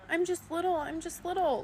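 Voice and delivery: put-on high, tiny, simpering little-girl voice; mocking baby voice. Creator mocks [name]'s act in a high baby voice. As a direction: pout out the words like a cutesy child. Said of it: "Like, why are you".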